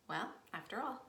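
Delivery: normal talking voice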